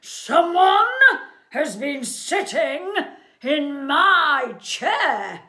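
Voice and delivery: loud, high-pitched growly bellow; big growly bear voice, outraged; growly Father Bear. Acted. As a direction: roar it out word by word, slowing at the end. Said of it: "with a growly voice".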